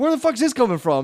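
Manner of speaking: high indignant question; mock bewildered outrage. Acts out baffled reply.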